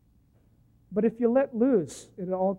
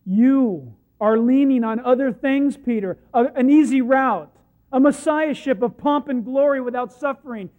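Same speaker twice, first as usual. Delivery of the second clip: loud preaching; 3 semitones higher, 10 dB louder than usual. Acted. Jesus correcting Peter, loud forceful preaching. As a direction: press each word on them.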